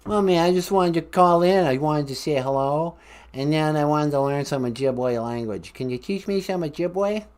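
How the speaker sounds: hammed-up Boston caller; Boston accent. Columbus asking host for Ojibwe lessons, Boston accent. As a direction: put on a chatty Boston accent. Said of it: "talk with my Boston accent".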